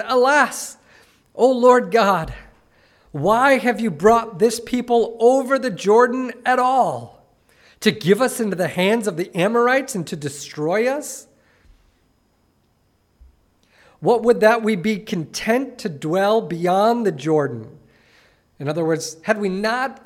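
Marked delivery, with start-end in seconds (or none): 0.0-7.3 higher, louder cry of despair; anguished cry. Acted. Joshua cries out to God after defeat, anguished. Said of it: "And Joshua said". 7.8-17.8 raised, earnest reading of the passage; scripture reading. Acted. Preacher reads Joshua's lament to the church, earnest reading.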